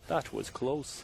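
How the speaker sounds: soft mock character voice; Fat Controller. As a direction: lightly imitate a storybook character, kept quiet. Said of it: "your Fat Controller voice".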